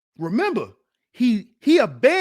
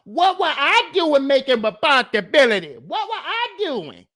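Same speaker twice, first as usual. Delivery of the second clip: higher, louder mocking imitation; mocking whine; 5 semitones higher, 5 dB louder than usual. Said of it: "Talking about".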